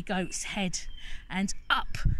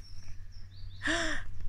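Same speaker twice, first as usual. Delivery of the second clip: dramatic gasp; 7 semitones higher, 4 dB quieter than usual. Acted. Storyteller gasps.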